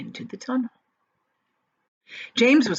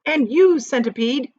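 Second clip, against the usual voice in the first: louder, higher call; shouting; 6 semitones higher, 5 dB louder than usual. A narrator's reading. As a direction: call him out sharply. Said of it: "James shouted".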